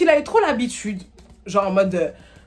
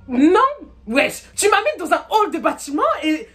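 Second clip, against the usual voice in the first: much higher, exasperated venting at him; indignant rant; 8 semitones higher than usual. Retold or imitated. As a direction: let it rip, outraged and half laughing. Rant at the date. (tirade indignée, voix très aiguë)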